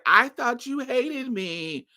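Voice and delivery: high, drawn-out mock wail; retold tearful accusation; imitated tearful outburst. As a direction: imitate a friend crying out, slow and high. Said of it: "she started bursting out crying".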